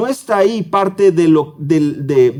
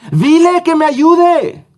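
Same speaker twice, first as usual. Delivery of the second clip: much higher, louder, slow shouted order; exclamatory demand; 12 semitones higher, 6 dB louder, much slower than usual. Acted. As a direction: cry it out like Marta demanding help with exclamation marks. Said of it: "Tiene signos de exclamación". (orden exclamada, mucho más aguda, fuerte y lenta)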